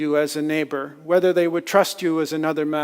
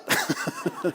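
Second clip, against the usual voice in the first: breathy laugh; 8 dB quieter than usual. Real, not acted. Laugh after the surprise.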